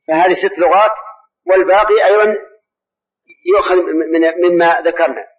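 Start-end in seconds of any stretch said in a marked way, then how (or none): none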